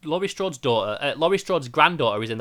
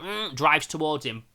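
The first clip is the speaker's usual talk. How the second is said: usual speaking voice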